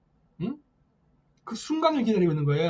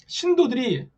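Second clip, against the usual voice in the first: ordinary talk, nothing marked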